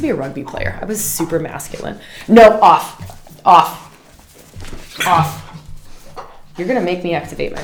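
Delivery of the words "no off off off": sharp loud command to a dog; firm real telling-off; real scolding. Real, not acted. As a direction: order the puppy off. Scolding the puppy.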